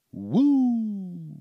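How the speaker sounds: high, drawn-out "woo"; high whoop gliding down. Real, not acted. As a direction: let out a long playful whoop. Whoops to punctuate his riff.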